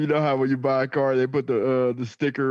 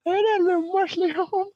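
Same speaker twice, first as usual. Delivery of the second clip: high goofy greeting; silly coworker voice. Pretend. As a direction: sing it out like a creepy neighbour. Mimicking gap-toothed coworker.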